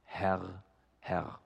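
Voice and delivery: low, hushed call to God; subdued, low plea; quiet prayer. Retold or imitated. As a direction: speak softly, as one lone man praying. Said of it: "der spricht".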